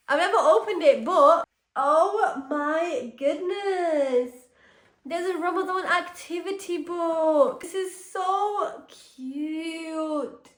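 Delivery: high, drawn-out cooing; overwhelmed, stretch every word high; gushing delight. Real, not acted. Gushing over the opened gift.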